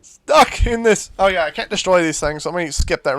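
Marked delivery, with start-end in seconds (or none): 0.0-1.1 baffled. Retold or imitated. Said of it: "I'm like".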